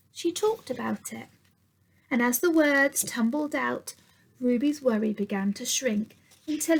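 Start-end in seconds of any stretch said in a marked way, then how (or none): none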